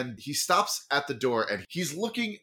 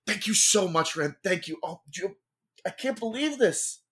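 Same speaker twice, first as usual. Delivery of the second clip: higher, fast, overwhelmed gratitude; gushing thanks; 9 semitones higher than usual. Acted. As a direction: pump his hand and gush. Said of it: "shake it emphatically".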